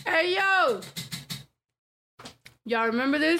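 high hype call; excited shout. Real, not acted. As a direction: hype the crowd up. Hyping up the game wheel.

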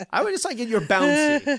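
ordinary delivery

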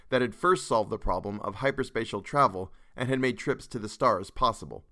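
usual speaking voice

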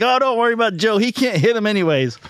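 high-pitched quoted hunter, dismissing the worry; acted reassurance. Friend tells the other guy about Joe, high dismissive call. Retells friend's line as punchline.

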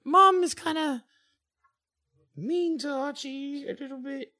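high, halting schoolgirl voice; upset, teary. Acted. Schoolgirl confiding that mom is mean to Archie, upset and teary. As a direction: falter as if close to tears. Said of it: "she kinda gets upset, and her eyes kinda get teary".

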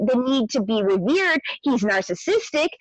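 ordinary delivery

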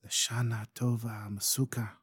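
softly whispered blessing; quiet whisper. Acted. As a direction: breathe the wish to the sleeping woman. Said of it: "quietly in a whisper".

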